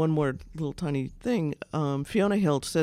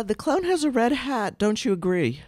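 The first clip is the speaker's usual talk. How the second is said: mocking congressmen grilling a witness, sing-song; mocking leading question; 6 semitones higher, 3 dB louder than usual. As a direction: ham up a loaded question to a witness. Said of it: "they kept trying to say".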